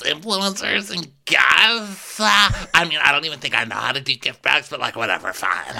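airy Emily voice. Retold or imitated. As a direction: babble nervously but breezily.

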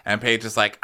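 ordinary delivery

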